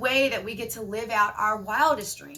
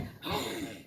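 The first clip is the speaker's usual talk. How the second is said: angry gasp; 9 semitones higher, 9 dB quieter than usual. Retold or imitated. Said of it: "I'm like".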